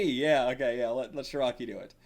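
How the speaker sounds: ordinary delivery, nothing marked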